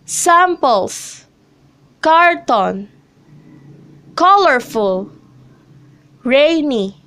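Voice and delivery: SAMples, CARton, COLorful, RAINy, opening syllable stressed; first-syllable stress. Shown as a demonstration. Teacher reads examples Samples, carton, colorful, rainy, each stressed on the first syllable. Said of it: "the first syllable takes on the stress".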